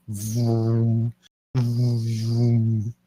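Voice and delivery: mouth-made lightsaber hum; lightsaber noises. Acted. Frat boys making their own saber noises, humming. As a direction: hum the saber sound with your lips. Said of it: "self-made S F X included".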